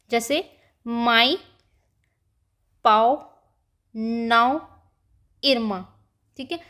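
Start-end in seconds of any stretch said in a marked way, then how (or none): 0.9-5.8 माई, पाओ, नाओ, इरमा, spaced out and hummed through the nose; nasal vowels. Shown as a demonstration. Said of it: "नाक से बोलना होता है".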